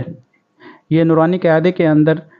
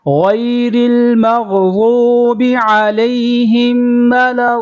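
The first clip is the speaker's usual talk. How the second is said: higher chant, final madd very slow and fading; tajweed chant with long madd; Quran recitation; 5 semitones higher, slower than usual. Shown as a demonstration. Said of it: "غین کو مخارج کے ساتھ،".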